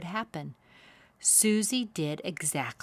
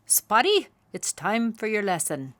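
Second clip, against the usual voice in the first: father owl calling; 4 semitones higher, 6 dB louder than usual. A narrator's reading. Father owl calls Spotty.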